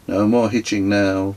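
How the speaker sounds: ordinary delivery, nothing marked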